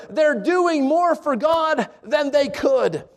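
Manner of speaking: each word laid out slowly; slow, weighty delivery; slow emphasis. Shown as a demonstration. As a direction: let every word sink in. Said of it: "Let this statement sink in".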